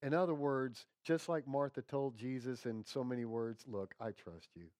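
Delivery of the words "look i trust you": lightly acted, low and soft surrender; understated resigned trust. Preacher voicing disappointed Martha to Jesus, quietly resigned. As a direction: drop low and quiet, as if giving in.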